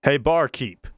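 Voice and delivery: calling out. A narrator's reading. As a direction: call the barkeep across the room. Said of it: "Callie called out".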